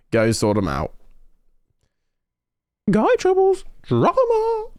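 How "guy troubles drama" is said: much higher sing-song mock voice; high teasing falsetto; mock gossip voice. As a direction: tease it like juicy gossip, pitched way up.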